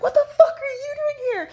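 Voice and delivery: screeching high, loud and laughing; shocked outburst. Retold or imitated. Blurting at the doctor she dislikes, high laughing shriek.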